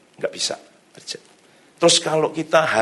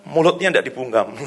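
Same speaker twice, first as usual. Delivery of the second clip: ordinary talk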